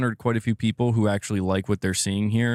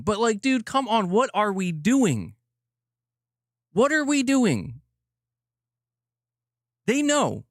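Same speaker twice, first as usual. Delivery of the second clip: high, drawn-out disbelieving rant; exasperated plea; 10 semitones higher, slower than usual. Real, not acted. Streamer asking Sony what we are doing, exasperated plea. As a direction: plead in stretched, high-pitched exasperation. Ranting exasperation at the game makers.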